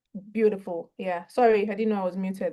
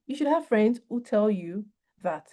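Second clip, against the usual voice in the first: usual speaking voice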